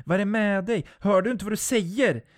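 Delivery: high, loud, outraged yell; screamed reproach. A narrator's reading. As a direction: scream at a friend in disbelief. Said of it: "skriker Jennifer". (upprört, gällt skrik)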